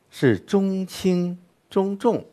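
中轻中重 high-pitched and very slow, light second syllable; stress pattern demo. Shown as a demonstration. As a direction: spell out 中轻中重 slowly with a light second beat. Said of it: "四字词呢".

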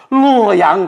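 exclaiming home. Acted. Poet thrilled to head for his home town. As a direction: cry out the name of home.

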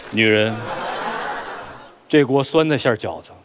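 lightly voiced bossy CEO; low, cool, commanding romance lead; domineering ceo. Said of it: "扮演那霸道总裁".

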